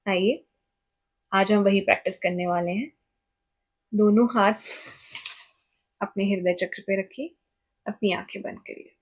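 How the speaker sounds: measured meditation voice with long pauses; guided meditation. Shown as a demonstration. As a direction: guide the listener phrase by phrase, leaving space. (निर्देशित ध्यान की ठहरी हुई आवाज़)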